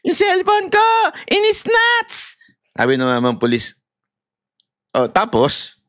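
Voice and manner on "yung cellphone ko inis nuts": shouting to police. Pretend. Robbed citizen shouting to a police officer. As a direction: cry out to the officer. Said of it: "sasabihin natin".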